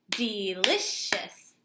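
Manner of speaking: Delicious clapped out slowly, DE-LI-CIOUS; clapped syllables. Shown as a demonstration. Teacher claps delicious for her class, slow. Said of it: "Let's clap the word delicious".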